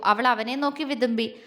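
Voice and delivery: usual speaking voice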